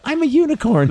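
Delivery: very high-pitched comic voice; high, cartoonish declaration; silly announcement. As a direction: squeal it like an absurd boast. Acting an absurd claim.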